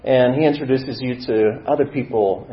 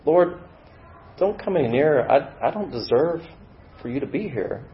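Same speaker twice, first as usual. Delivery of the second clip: high, fragmented plea, growing softer; humble pleading; 4 semitones higher, 4 dB quieter than usual. Acted. Struggler on their knees before the Lord, humble pleading. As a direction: plead unworthiness, haltingly.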